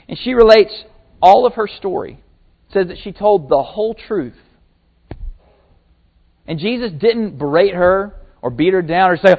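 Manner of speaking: ordinary talk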